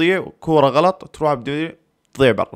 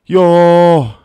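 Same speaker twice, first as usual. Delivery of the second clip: long, high, loud "yooh"; loud cry of dismay; 6 semitones higher, 8 dB louder, much slower than usual. Real, not acted. Fan reacting to the match, loud cry of dismay. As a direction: let out a drawn-out high yell. Cries out at missed chance.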